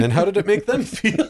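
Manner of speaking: the moviegoer's rant dissolving into laughter, high and amused; laughing disbelief. Retold or imitated. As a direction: crack up mid-question at the absurdity. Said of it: "you're just like".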